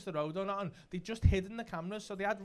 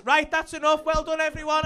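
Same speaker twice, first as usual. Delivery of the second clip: loud, higher director's call to everyone; director wrapping up; 9 semitones higher, 10 dB louder than usual. Retold or imitated. Director ending the scene for everyone, loud brisk dismissal. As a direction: call time on the whole set. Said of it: "then he went".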